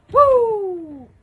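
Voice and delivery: very high, loud, sustained "woo"; wrestler whoop. Pretend. Wrestler hyped on his own lock, whooping. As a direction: let out a long falling whoop.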